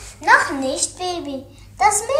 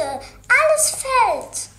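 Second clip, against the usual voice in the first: whiny complaint; 6 semitones higher, 6 dB louder, much slower than usual. Pretend. Kind klagt bei Mama.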